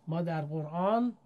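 usual speaking voice

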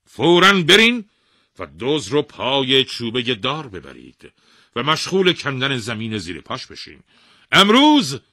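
very loud, high commanding shout; royal proclamation. A narrator's reading. King ordering his men to dig, commanding. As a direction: proclaim the order at full force. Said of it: "اعلام کرد".

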